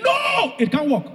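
a shrill high scream of protest. Retold or imitated. A student screaming in the department at his D. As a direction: yell it out in shock at the failing grade. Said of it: "I screamed".

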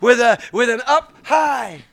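loud shout rising to the last words; big, excited preacher's punchline; triumphant callback. Shown as a demonstration. Callback shout of the high-five line.

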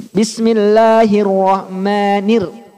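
"Bismillahirrahmanir" drawn out slowly, chanted; slow recited demo. Shown as a demonstration. Teacher reciting slowly for students.

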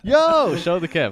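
loud high call out; excited shout. Real, not acted. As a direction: yell for them to show it. Calling out to see screen.

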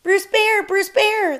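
very high, loud little mouse call; tiny voice. A narrator's reading. A tiny mouse waking a hibernating bear, high tiny calling voice. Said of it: "the tiny voice echoed".

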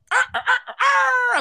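high, slow rooster crow imitation; pterodactyl shriek. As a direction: screech like a crowing rooster. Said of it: "your pterodactyl sound".

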